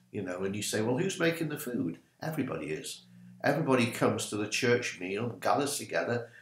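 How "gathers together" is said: usual speaking voice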